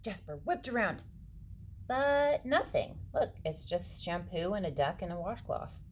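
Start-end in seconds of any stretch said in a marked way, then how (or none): none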